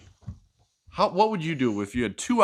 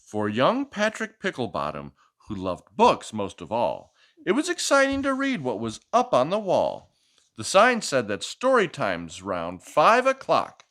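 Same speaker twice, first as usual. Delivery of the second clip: lively picture-book read-aloud; reading to a child; 7 semitones higher than usual. Acted. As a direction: read it like a bedtime story for a little one.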